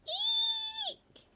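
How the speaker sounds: like a cat whose tail got trodden on. A narrator's reading. Baby owl shrieks again for his parents, a piercing squeal. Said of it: "the noise a cat makes if you accidentally tread upon its tail".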